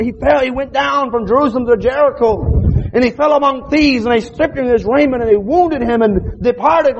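high, rising revival preaching; preaching at full force. Acted. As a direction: preach it out to the pews. Preacher builds the parable.

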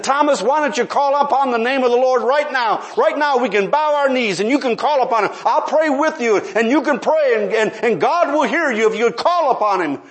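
preacher imitating himself begging a coworker to pray; begging plea. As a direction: plead with him to pray now. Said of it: "I begged, I pleaded".

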